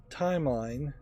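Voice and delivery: ordinary talk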